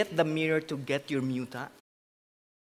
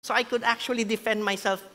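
normal talking voice

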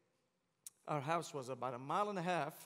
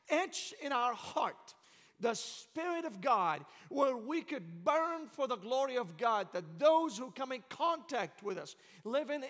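loud, rising revival preacher cadence; fiery preaching; 4 semitones higher, 4 dB louder than usual. Acted. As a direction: build it like a pulpit call to fire. Preaching revival at full force.

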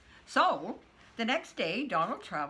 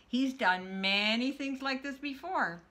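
ordinary delivery

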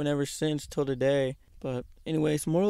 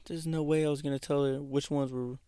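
ordinary delivery

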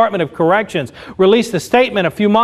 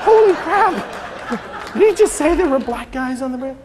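shocked disbelief; 3 semitones higher, 3 dB quieter than usual. Retold or imitated. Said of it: "I'm like".

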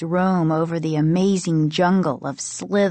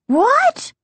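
loud, very high-pitched yelp; squeaky high shock; 5 dB louder than usual. A narrator's reading. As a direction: let it leap up in startled disbelief. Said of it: "several octaves higher than normal".

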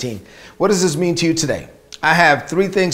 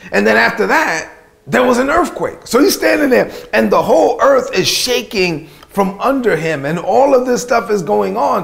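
rising excitement; 6 semitones higher, 3 dB louder than usual. Acted. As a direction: pitch climbs high and louder as the quake hits. Preacher builds the earthquake scene.

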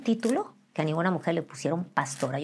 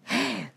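sudden higher intake of breath; shocked gasp; 5 semitones higher, 4 dB quieter than usual. Acted. Catching herself being rude to the ex, shocked gasp. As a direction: gasp as if catching your own rudeness.

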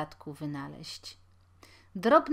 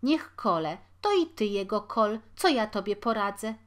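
louder, bitter reply; harsh mother; 3 semitones higher, 6 dB louder than usual. A narrator's reading. Mother to her sick son, louder and bitter. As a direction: brush him off, helpless.

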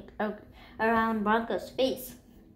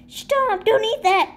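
high loud yell of stop; 9 semitones higher, 6 dB louder than usual. A narrator's reading. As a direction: shout to stop them eating. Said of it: "Cobb yelled about".

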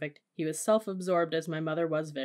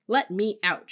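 loud, higher frantic demand; screeched; 4 semitones higher, 8 dB louder, slower than usual. A narrator's reading. Mother wanting out of the car, screeched demand. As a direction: cry out to be released. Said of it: "she screeched".

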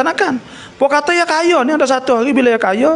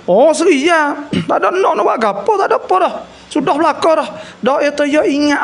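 put-on higher, gentle old teacher recalling, with a little laugh; old ustaz voice; 6 semitones higher than usual. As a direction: lift the voice into a kindly old ustaz.

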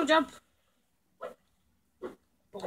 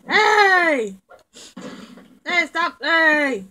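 loud angry yelling; shouting; 4 semitones higher, 8 dB louder, much slower than usual. Acted. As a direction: yell hey to stop them. Yelling at attacker.

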